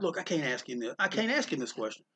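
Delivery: half-muttered, not wanting Eisner to hear; hushed refusal. Retold or imitated.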